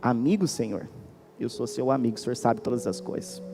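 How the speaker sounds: mild retold weeping, voice sinking low; Peter weeping. Peter answers Jesus through tears, softly and mildly tearful. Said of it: "começou a chorar".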